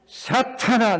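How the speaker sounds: sharp high call of rebuke; stern rebuke. Acted. Jesus rebuking his disciple Peter, sharp and stern. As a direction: cast him off with one word.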